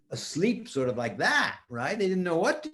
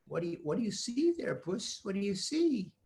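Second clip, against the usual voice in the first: quiet; 4 semitones higher, 7 dB quieter than usual. Acted. Peter whispering to cat.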